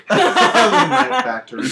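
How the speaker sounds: high, loud, slow laughing announcement. Real, not acted. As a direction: laugh it out like a show title. Laughing at spinoff idea.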